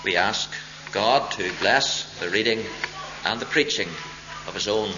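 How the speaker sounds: low, hushed prayer voice; softly spoken pulpit prayer. Real, not acted. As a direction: pray quietly and reverently, trailing away. Brief prayer before preaching.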